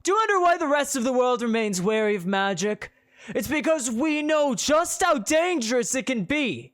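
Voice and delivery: loud, high preacher voice; a zealot rousing the street; loud preaching. Acted. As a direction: preach it to the crowd at full volume. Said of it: "in a loud voice".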